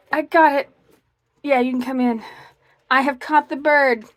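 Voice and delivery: loud, high victory cheer; triumphant. Real, not acted. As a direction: celebrate the catch. Finally catches the bird.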